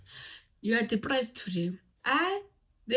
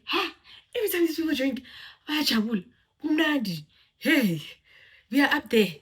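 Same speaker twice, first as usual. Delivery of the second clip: a louder, higher excited drinker voice; mock cheerful drinker; 6 semitones higher, 4 dB louder than usual. The drinker cheering how alcohol lifts everyone's mood. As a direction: cheer like someone who loves the party.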